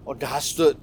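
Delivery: ordinary delivery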